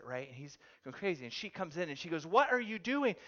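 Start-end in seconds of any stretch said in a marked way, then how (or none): 2.3-3.1 higher, louder demand; imitating an annoyed wife calling him out; exasperated wife. Wife walks in on husband vacuuming, exasperated.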